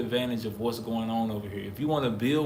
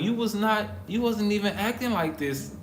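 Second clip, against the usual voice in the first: higher-pitched mild protest; retold disbelief; 8 semitones higher than usual. Baffled at accusers' sudden turn, retold disbelief. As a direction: lightly act out baffled objection.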